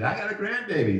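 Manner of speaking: excited. Retold or imitated. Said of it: "She was excited".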